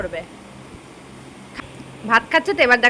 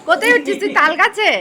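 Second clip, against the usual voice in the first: playful teasing; 6 semitones higher, 4 dB louder, faster than usual. Real, not acted. Teasing toddler climbing papa.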